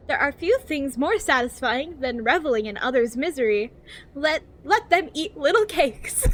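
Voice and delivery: over-enunciated guidebook narration, lilting up and down; cheery infomercial voice. Acted. Reading a survival guide to new players, cheery infomercial voice. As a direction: read it like a relentlessly positive infomercial. Reads the game's guide book.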